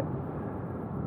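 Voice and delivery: breathy whisper under his breath. Real, not acted. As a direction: whisper it to yourself. Muttering to himself on ride.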